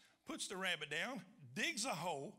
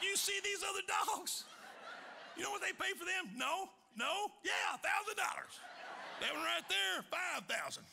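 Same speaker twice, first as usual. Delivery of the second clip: gleeful praise to dog; 12 semitones higher than usual. Retold or imitated. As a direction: gush at the dog in triumph. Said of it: "I said".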